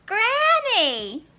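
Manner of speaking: put-on high, loud, slow vain girl; Little Red. Little Red admiring herself to Granny, high and slow.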